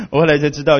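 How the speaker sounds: ordinary talk, nothing marked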